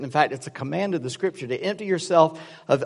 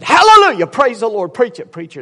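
imitating a loud, high hallelujah outburst; excited praise; 6 semitones higher, 9 dB louder than usual. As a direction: erupt in praise, then ease off. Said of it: "he got all excited".

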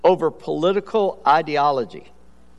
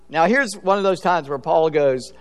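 ordinary delivery

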